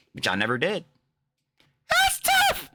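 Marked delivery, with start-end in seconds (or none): 1.9-2.7 shrill mock squeal, much higher and louder. Real, not acted. Mocking the snarkers with a high squealed taunt.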